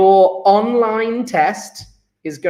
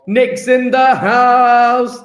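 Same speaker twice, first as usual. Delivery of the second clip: loud, drawn-out, higher-pitched welcome; hyped announcement; 4 semitones higher, 6 dB louder, much slower than usual. Real, not acted. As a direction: hail an arrival like an MC. Announcing an arrival on register.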